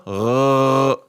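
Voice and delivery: "Gha" from the back of the throat like gargling; gargled Gha. Shown as a demonstration. Teacher shows the Ghain sound, gargling like water. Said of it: "when you gargle in water?".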